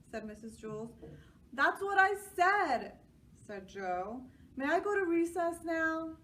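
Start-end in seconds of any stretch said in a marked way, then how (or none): 1.5-3.0 put-on high, loud boyish insistence; child voice. A narrator's reading. Joe insisting. 4.6-6.2 put-on high eager boy's question; child voice. A narrator's reading. Joe asks.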